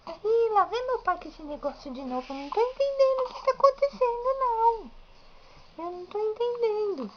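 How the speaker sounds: put-on high, fast, squeaky baby voice. Mother voicing the baby puzzled by dad's camera, squeaky and high. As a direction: whine it like a puzzled little baby. Baby voice complaining about dad filming.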